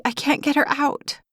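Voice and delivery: put-on a young mother near hysteria, pleading; high frightened voice breaking off; hysterical mother. A narrator's reading. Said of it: "stood there in near hysteria".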